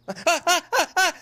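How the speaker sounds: loud, high staccato laughter; high frantic laugh. Real, not acted. Gamer reacts to sudden mishap, frantic laughing. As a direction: burst out in high, quick ha-ha-has. Disaster in the game.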